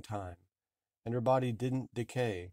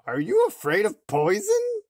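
put-on disguised old woman; 10 semitones higher, 10 dB louder than usual. A narrator's reading. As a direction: croak it sweetly as a disguised hag. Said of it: "said the old woman".

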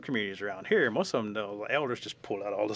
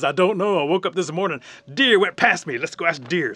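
loud, raised animal voice; excited, higher, shouted reply; running bear; 7 semitones higher, 14 dB louder than usual. Acted. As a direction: holler the explanation mid-chase. Bear answers on the run.

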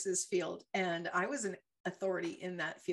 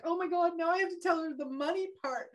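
panicked inner voice; 9 semitones higher, 3 dB louder, faster than usual. Retold or imitated. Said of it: "And I'm like".

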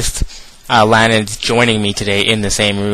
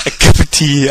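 Mock British, higher and louder, falling apart; failed British accent; 5 semitones higher, 7 dB louder than usual. Podcaster attempting a British accent and giving up. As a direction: try a posh Brit and fail. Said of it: "I can't do it".

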